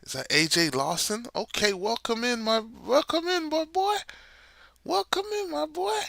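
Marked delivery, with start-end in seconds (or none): 1.4-6.0 squeaky high-pitched welcome; high cartoonish hype voice; high hype greeting. Greeting a player coming in.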